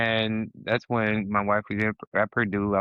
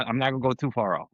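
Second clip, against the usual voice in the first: normal talking voice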